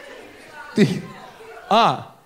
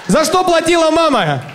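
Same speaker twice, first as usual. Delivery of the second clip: mock outrage; 9 semitones higher, 4 dB louder than usual.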